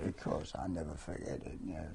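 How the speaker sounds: usual speaking voice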